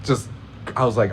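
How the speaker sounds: ordinary talk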